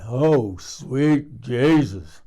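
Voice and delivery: loud, long-drawn swoon of shock; drawn-out retold astonishment; stunned exclamation. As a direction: stretch out a loud, overwhelmed reaction. Retells her stunned reaction.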